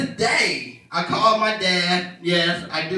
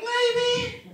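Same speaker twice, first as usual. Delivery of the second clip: sheepish high maybe. Retold or imitated. Son sheepish answer to dad.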